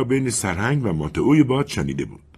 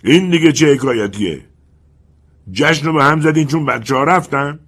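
higher, louder indignant yell; shouting; 7 semitones higher, 5 dB louder than usual. Acted. Colonel shouts at the spirits for stopping the party. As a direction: demand an answer, loud and sharp. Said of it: "پروکولو داد زد".

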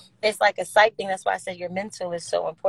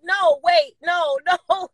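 mock protest; 9 semitones higher, 4 dB louder, slower than usual. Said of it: "and he's like".

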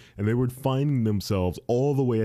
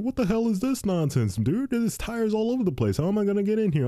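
much higher, indignant delivery guy; mock angry driver; 11 semitones higher than usual. New delivery guy complaining about tires, higher indignant mock voice. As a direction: squawk like an affronted driver. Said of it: "He's like".

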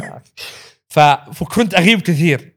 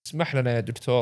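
quiet apology; 4 semitones lower, 9 dB quieter than usual. Retold or imitated. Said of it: "فألتفت له".

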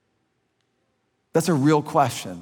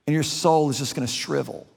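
normal talking voice

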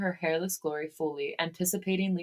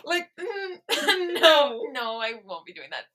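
high giggly refusal; laughing; 8 semitones higher, 4 dB louder than usual. Real, not acted. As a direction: squeal it through laughter.

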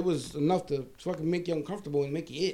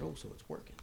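smug undertone; 3 semitones lower, 17 dB quieter than usual. Retold or imitated. Smug aside about the smell.

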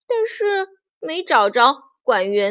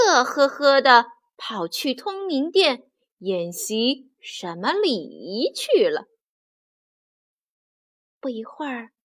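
ordinary delivery

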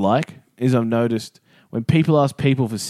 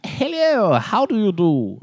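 high, slow, hammed-up posh English; hammed-up posh British greeting, pitched up; 8 semitones higher, slower than usual. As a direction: play a prim English royal. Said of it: "they wave by turning their hand".

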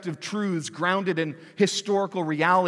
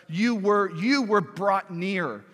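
"you" stressed, louder, slower; emphatic scripture quote; 2 semitones higher, 4 dB louder, slower than usual. Acted. Preacher paraphrasing Paul to the Gentiles, slow and emphatic. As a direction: land "brought near" with weight. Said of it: "He's saying".

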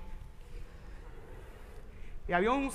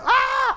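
high mock yell at the board; high hammed-up yell; 13 semitones higher, 8 dB louder than usual. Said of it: "Había que gritarle a la tabla".

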